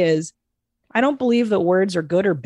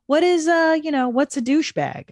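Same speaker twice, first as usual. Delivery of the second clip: put-on kid voice; 8 semitones higher than usual. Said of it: "it's literally just like".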